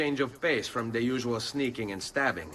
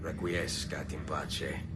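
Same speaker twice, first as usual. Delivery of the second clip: solemn farewell; 7 semitones lower, 6 dB quieter than usual. Acted. Assassin's ritual blessing to victim.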